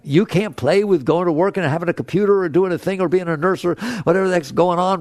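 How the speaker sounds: normal talking voice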